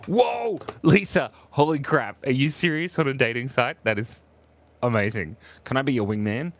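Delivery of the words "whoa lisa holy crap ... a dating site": stunned, pitched-up exclamation; real astonishment. Real, not acted. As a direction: burst out amazed at a friend's news. Shocked by friend's dating news.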